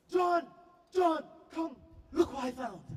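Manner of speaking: very high, loud excited shout; calling a friend. Retold or imitated. British girl calling her friend over, excited shout. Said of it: "But I have to call my friend".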